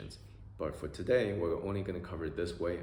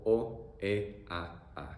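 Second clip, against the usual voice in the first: ordinary delivery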